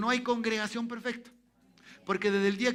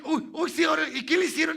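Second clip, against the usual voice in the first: alarma imitada; 7 semitones higher, 7 dB louder, faster than usual. Retold or imitated. Retold alarm over missing monitor.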